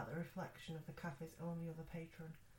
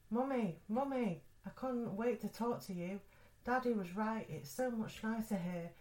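put-on high, loud, eager child's voice; little girl voice; 6 semitones higher, 7 dB louder than usual. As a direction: call out like an excited little girl. Dead daughter calls her mother.

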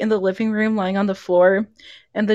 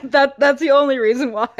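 words breaking into laughter; real laughing; 2 semitones higher than usual. Real, not acted. Mocking the camera-angle reason, laughing. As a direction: chuckle through the words.

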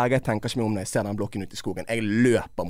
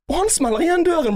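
much higher, louder, laughing; excited climax; 4 dB louder than usual. Real, not acted. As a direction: peak of the story, laughing. (høy, ivrig latter)

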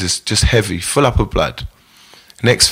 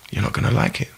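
hushed warning; 8 dB quieter, faster than usual. Retold or imitated. Brando warns nephew in story.

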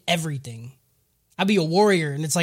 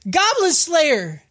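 higher, slow drawn-out call of his name; 9 semitones higher, 3 dB louder, much slower than usual. Retold or imitated. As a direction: receptionist calling after him, slow and high. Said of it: "the receptionist goes".

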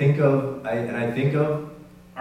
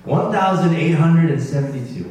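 raised, emphatic voice announcing a big figure; loud announcement; 4 semitones higher, 6 dB louder than usual. Real, not acted. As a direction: call out the total, loud and higher. Announces the total to the room.

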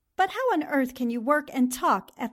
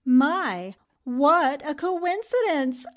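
loud, drawn-out mock wonder; exaggerated, slow exclamation; mock amazement; 5 dB louder, slower than usual. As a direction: marvel at it with teasing emphasis. Teasing the film plot.

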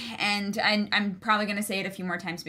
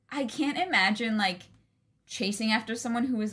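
ordinary talk

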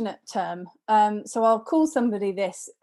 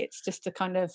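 usual speaking voice

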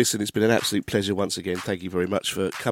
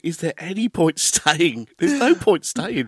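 mock despair; 6 semitones higher, 3 dB louder than usual. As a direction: sigh it out like a fan leaving early. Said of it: "and I think".

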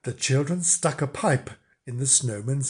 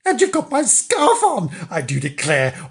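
much higher, louder outraged roar; Mister Flange roaring in outrage; 5 semitones higher, 3 dB louder than usual. Acted. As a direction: roar at the thief. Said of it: "roared Mister Flange".

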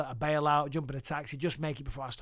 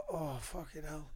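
groggy groan; 9 dB quieter, slower than usual. Retold or imitated. Waking groggy on the plane.

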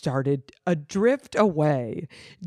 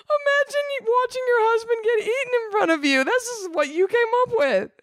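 much higher, fast squeaky disbelief; mock incredulous; faster than usual. Real, not acted. Reader teases the young author, high squeaky disbelief. Mocking the child writer.

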